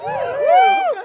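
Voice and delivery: very high, loud okay; very loud okay to cheering fans; loud excited shout. Real, not acted. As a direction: cheer the crowd. Crowd cheer response.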